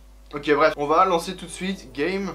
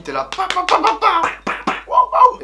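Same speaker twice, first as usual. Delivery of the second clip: high loud pa pa pa popping sounds; squeaky rising pops like bursting bubbles; bubble popping noises; 9 dB louder than usual. Retold or imitated. Imitating bubble wrap pops for viewers, high and loud.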